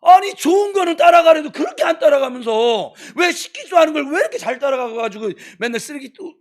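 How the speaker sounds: real exasperated rant, high and loud; scolding outburst. Real, not acted. Pastor berating congregation for following bad examples, scolding loudly. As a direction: berate them at full voice, then ease slightly.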